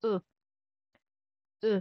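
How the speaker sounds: "U" said as one short French vowel, like a double O; French U sound. Shown as a demonstration. Teacher showing learners the letter U, French vowel. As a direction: model a brief French "U". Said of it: "tunog ni letter U in French".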